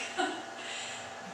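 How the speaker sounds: quiet breathy laugh; soft laugh. Acted. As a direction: laugh softly to yourself. Mother laughs.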